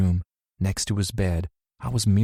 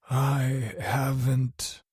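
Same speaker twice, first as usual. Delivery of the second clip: weak attempt to speak; 5 semitones higher, 4 dB quieter, much slower than usual. A narrator's reading. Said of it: "he tried to speak".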